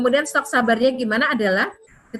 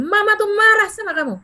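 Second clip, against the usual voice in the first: loud, high, angry telling-off; raised angry voice; 10 semitones higher, 5 dB louder than usual. Shown as a demonstration. Mother demonstrating the wrong way to scold her child, loud and high. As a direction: snap at the child at full volume. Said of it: "kita nggak akan bilang gini".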